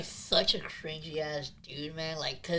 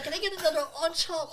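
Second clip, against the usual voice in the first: a higher, prissy mock order, laughing; a light mocking Drake voice; frilly Drake impression; 9 semitones higher than usual. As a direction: camp it up like a fussy customer. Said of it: "he fucking said it frilly too".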